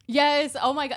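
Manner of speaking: loud, excited outburst of recognition; emphatic agreement. Real, not acted. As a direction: burst in high and loud, in full agreement. Eagerly agreeing about Florida news.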